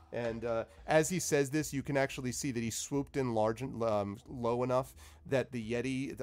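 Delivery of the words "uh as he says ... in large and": ordinary delivery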